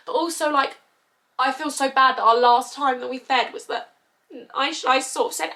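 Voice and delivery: tearful. Real, not acted. Mum blaming herself, tearful and strained. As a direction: still tearful, voice high and strained. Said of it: "blaming myself".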